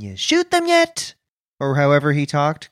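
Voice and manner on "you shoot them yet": much higher, slow Chandler Bing voice; Matthew Perry impression. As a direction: do Chandler as a sarcastic space villain. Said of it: "cast Matthew Perry as the space villain".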